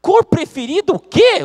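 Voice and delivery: loud, high, slow disbelief; outraged retort. Acted. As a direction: blow up at the silly excuse.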